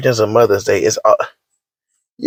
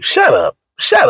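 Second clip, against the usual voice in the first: mocking high voice; slower than usual.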